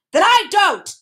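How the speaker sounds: loud, high angry refusal; stubborn, furious outburst; thundering. A narrator's reading. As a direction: thunder it out defiantly. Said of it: "he thundered again".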